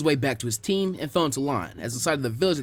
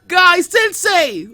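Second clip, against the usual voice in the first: high, drawn-out wailing call, louder; sobbing cry; 10 dB louder, much slower than usual. Acted. Lee wailing his teacher's name in a tearful embrace. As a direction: wail the name through tears. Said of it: "crying just as heavily".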